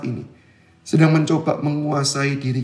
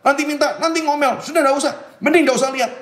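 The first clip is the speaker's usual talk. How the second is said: scolding parent; 9 semitones higher, faster than usual. Retold or imitated. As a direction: snap at the child to stop looking. Parent scolding child in shop. (omelan ibu yang keras dan tinggi)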